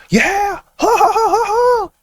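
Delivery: very high-pitched, braying fake guffaw; loud mock laughter. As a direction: imitate an obnoxious stranger laughing loudly. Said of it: "Just laughing loud".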